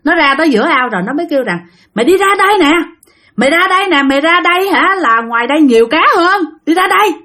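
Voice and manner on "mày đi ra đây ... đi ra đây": calling out. Retold or imitated. Friend calling out from pond.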